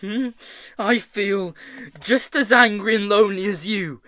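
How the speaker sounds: high, loud, heated tirade; angry screaming. A narrator's reading. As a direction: rant at a friend in pain and anger. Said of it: "Tom screamed".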